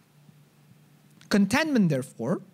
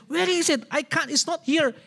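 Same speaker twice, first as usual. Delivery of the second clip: higher, insistent complaining husband who can't find it; frustrated husband; 9 semitones higher than usual. Retold or imitated. Husband at the fridge whining to his wife, higher and insistent. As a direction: pitch it up, exasperated and sure it's gone. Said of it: "But then we say".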